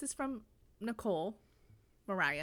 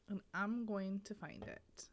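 whispering; 8 dB quieter, slower than usual. Real, not acted. Muttering to herself while searching.

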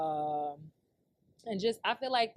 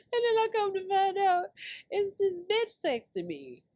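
high-pitched, worked-up storytelling; amused big reveal; 11 semitones higher, 3 dB louder than usual. Real, not acted. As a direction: announce the twist in a raised, tickled voice. Delivers the reveal while cracking up.

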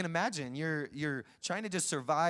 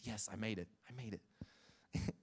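hushed glee; 3 semitones lower, 8 dB quieter, slower than usual. Retold or imitated. John chosen to enter.

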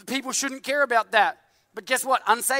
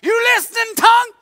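much higher, much louder shout; yell; 13 semitones higher, 10 dB louder than usual. Retold or imitated. The preacher shouts at his tongue, furious in jest. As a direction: bark the question at your tongue.

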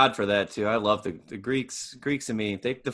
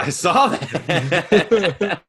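slow laughing; 6 semitones higher, 3 dB louder, much slower than usual. Real, not acted. Laughing reply to stutter bit.

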